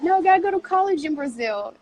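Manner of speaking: high, fast mock-mom voice; insisting mom. Daughter retelling mom's orders about college, mock insistent. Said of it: "she was like".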